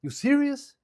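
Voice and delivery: high, squeaky-pitched little dog in disbelief; high-voiced cartoon dog reacting; incredulous Chihuahua. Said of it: "The Chihuahua gonna be like".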